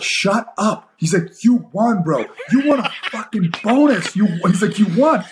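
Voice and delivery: high, laughing disbelief at his friend; a hint of a buddy's giddy cheer; excited teammate. Teammate in the ambulance telling him he won, excited and laughing.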